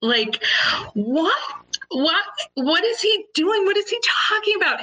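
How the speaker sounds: high-pitched, incredulous questioning; stunned, stammered repeats climbing high; horrified disbelief. Retold or imitated. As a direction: reenact being appalled and baffled, voice shooting up. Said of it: "I'm horrified".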